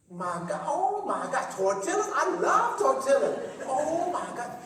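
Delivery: high and loud, thrilled over tortillas; delighted exclaim. Retold or imitated. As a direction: gush with delight about the gift.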